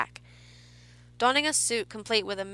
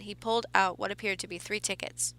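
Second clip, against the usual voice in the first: ordinary delivery, nothing marked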